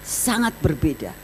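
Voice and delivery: ordinary talk, nothing marked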